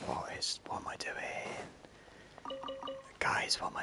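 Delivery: baffled whisper; unvoiced stunned whisper. Real, not acted. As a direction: breathe it to the viewers in disbelief.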